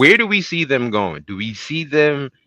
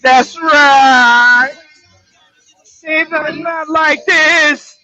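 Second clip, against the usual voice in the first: hype intro; 10 semitones higher, 8 dB louder than usual. Acted. Hype podcast opener over music.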